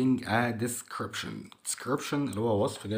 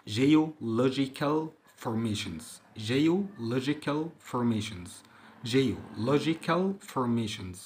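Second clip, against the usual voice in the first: slow drill; much slower than usual. Shown as a demonstration. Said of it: "تكوينات جيولوجية".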